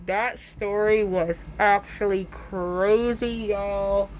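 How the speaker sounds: slow, stretched-out words; drawn-out delivery. Pretend. Storyteller reacting to own tale, slow drawn-out delivery. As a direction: draw every word out playfully.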